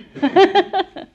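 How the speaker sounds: high, loud burst of laughter; hearty laugh. Real, not acted. Woman ending her story of the bra, hearty laugh.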